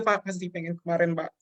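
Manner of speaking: choked up, pausing to gather composure; real tearful. Real, not acted. Guest confessing hardship to the interviewer, tearful. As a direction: push through tears in halting bursts. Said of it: "saya cengeng".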